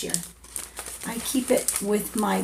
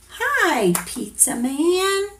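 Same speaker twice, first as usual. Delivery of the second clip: higher sing-song hello; cheerful greeting; 8 semitones higher than usual. Acted. Reading to a toddler, greeting the pizza man, cheerful. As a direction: call out to the pizza man, pitched up. Reading the book's refrain aloud.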